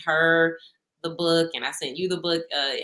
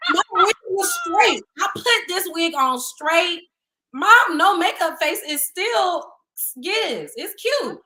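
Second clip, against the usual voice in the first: much higher and louder, defending herself; indignant; 11 semitones higher, 5 dB louder, slower than usual. Real, not acted. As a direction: insist loudly that you looked fine. Indignant defence of her look.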